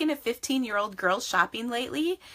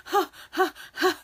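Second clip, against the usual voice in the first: mock nervous laugh; 9 semitones higher, 3 dB louder than usual. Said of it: "And I'm like".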